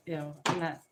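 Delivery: ordinary talk, nothing marked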